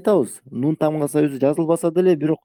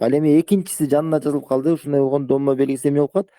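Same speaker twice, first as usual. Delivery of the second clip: ordinary talk, nothing marked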